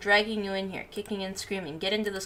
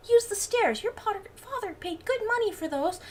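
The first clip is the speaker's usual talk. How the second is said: scolding mom; 13 semitones higher than usual. Acted. Bee mom scolding Barry about the stairs, high nagging voice. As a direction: nag him about the stairs.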